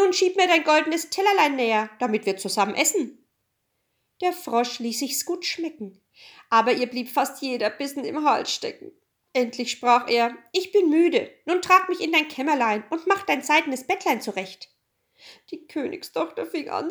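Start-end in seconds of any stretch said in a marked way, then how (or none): 0.0-3.3 quick frog voice, opening high; demanding frog. A narrator's reading. Frog demands to share the princess's plate, quickly. 10.5-14.7 high frog voice; demanding frog. A narrator's reading. Frog demands to be carried to bed, high-pitched.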